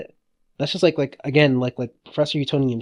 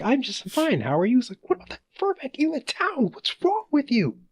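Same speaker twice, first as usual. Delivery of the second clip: high, squeaky, laughing impression; high cartoon voice mocking the mayor; 14 semitones higher than usual. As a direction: pitch it way up like a cartoon. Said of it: "It's just like".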